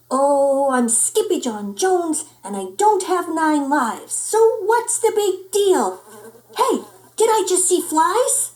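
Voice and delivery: put-on high-pitched, carefree little-boy voice; playful kitten bravado; cocky kitty boy. A narrator's reading.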